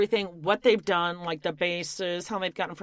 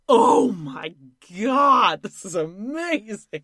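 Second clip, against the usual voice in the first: louder, slow gushing over the drink; mock gushing Heather; 6 dB louder, much slower than usual. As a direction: gush like it's the best thing ever. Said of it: "She's like".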